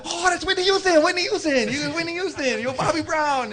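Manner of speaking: high, gushing mock amazement; starstruck excitement. Cook suddenly recognizes [name] and [name], mock starstruck.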